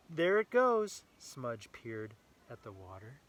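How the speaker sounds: lively read-aloud storytelling voice; story narration. A narrator's reading. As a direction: read it brightly to a small child. Reading picture book to child.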